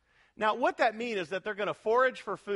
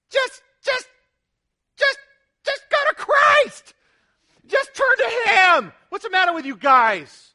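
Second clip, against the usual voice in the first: much higher, louder, slow pleading yell; exasperated shout; 13 semitones higher, 13 dB louder than usual. Retold or imitated. Preacher yelling at the disciples from his study, exasperated shout. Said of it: "and I'm going".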